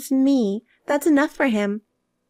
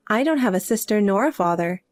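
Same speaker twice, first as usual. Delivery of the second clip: ordinary talk, nothing marked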